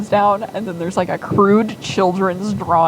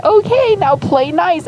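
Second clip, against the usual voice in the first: much higher, louder sing-song order; sing-song daughter; 12 semitones higher, 8 dB louder than usual. Retold or imitated. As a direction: chirp it up high like a bossy kid. Daughter chirping orders at dad.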